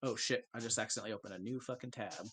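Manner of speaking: usual speaking voice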